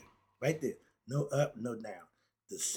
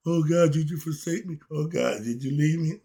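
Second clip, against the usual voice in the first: louder, pleading cry to God; despairing believer; 4 semitones higher, 10 dB louder than usual. Retold or imitated. Believer asks God if he left, louder, pleading. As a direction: play the abandoned believer calling out loudly.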